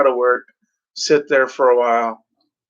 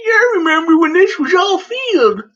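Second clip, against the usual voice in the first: very high, put-on old man's quaver; dreamy old-timer remembering the fields; mock old man; old codger reminiscing; 4 dB louder than usual. Imitating daydreaming old driver.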